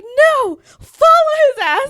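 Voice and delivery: high, loud reenacted command; retold outburst. Retelling own heated order to follow.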